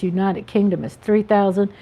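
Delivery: ordinary talk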